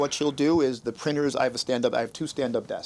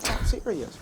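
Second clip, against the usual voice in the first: pitched up and earnest, protesting; insisting, higher; 9 semitones higher than usual. Retold or imitated. Husband insisting to his wife that she is beautiful, high and earnest. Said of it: "I'm like".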